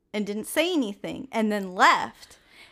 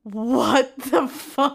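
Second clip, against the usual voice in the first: slow disbelief; 4 dB louder, much slower than usual. Retold or imitated. Retold panic reaction.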